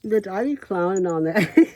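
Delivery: hammed-up boss swagger; sassy diva voice. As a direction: clown it up, full diva. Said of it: "When I'm here like this".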